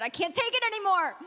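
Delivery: hammed-up desperate outburst; mock caving in. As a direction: crack suddenly under the temptation. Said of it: "you end up caving".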